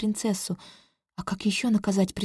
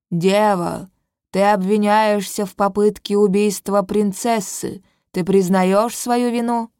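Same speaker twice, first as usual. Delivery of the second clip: louder, slow stern elder; an old judge pronouncing charges; wise old sage; 5 dB louder, slower than usual. Acted. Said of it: "голос старца". (строгий голос старца)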